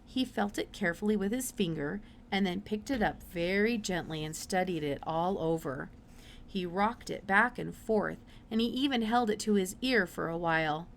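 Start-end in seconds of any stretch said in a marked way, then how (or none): none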